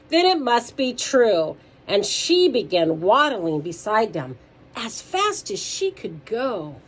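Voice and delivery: loud, lifted storytime voice; lively read-aloud for kids; animated children's story reading. A narrator's reading. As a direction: read it out big for little listeners.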